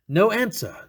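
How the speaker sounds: ordinary delivery, nothing marked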